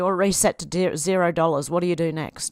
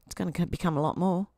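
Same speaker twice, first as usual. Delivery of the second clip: normal talking voice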